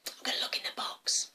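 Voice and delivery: whispered little secret plan; sneaky whisper. Acted. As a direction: whisper it as a child up to mischief. Girl plots in secret.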